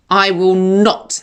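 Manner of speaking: slow, heavy stress on "not"; capital not. A narrator's reading. Boy vowing he won't repeat his parents' choice, "not" hit hard and slow. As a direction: lean hard on "not", slowly. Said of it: "and that's a capital not".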